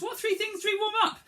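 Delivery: high, fast quiz question; quizzing question. Acted. Teacher quizzing the children, high and quick. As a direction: ask it high and quick like a game.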